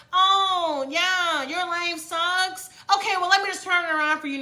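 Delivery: high, sing-song fake kindness; mock sweet universe. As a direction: play the universe as a sugary pushover. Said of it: "the universe is like".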